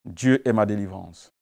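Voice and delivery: ordinary delivery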